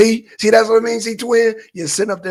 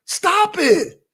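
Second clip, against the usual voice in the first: ordinary delivery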